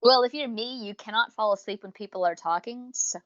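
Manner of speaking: ordinary talk, nothing marked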